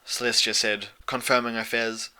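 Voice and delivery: ordinary delivery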